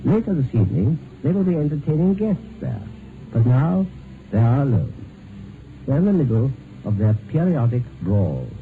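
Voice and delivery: story narration. A narrator's reading. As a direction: set the scene for the listener like a radio narrator.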